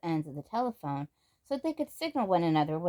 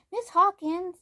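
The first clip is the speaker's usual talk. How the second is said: much higher, loud breathless call; breathless cry; 12 semitones higher, 6 dB louder, slower than usual. Acted. Samantha rushes in calling the cook, high breathless cry. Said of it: "Samantha cried breathlessly".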